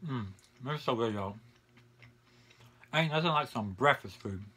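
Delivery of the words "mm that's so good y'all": speaking between bites; chewing while talking. Real, not acted. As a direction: mouth partly full of food. Seen 5: Bite at -5; jaw keeps working, cheeks full through labelled words.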